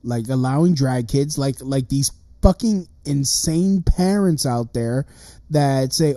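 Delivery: ordinary talk, nothing marked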